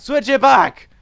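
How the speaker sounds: high, loud shouted order; angry demand. Pretend. Player telling game crew to undo controls, angry shout. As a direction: yell for it to be undone. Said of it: "I'm getting too angry".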